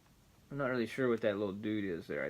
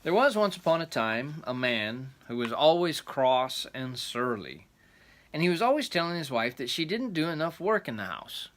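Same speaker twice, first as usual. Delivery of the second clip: storyteller reading aloud; rambling grandpa story; 2 semitones higher, 4 dB louder than usual. A narrator's reading. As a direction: read it like a grandpa's tale. Reading the folk tale aloud.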